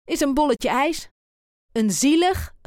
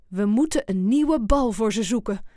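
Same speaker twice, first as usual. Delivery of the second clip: ordinary delivery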